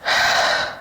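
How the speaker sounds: long unvoiced sigh; heavy sigh. Real, not acted. After chapter end.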